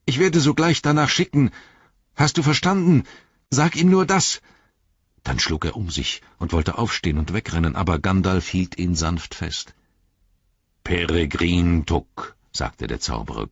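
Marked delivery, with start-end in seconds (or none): 0.0-4.4 very high, insistent demand; shrill, toneless voice. A narrator's reading. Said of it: "rief er mit schriller, tonloser Stimme". 10.8-12.4 slow, drawn-out wizard's summons; summoning wizard. A narrator's reading. Wizard summons the hobbit back.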